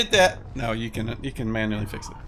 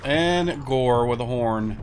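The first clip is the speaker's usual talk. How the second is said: ordinary talk, nothing marked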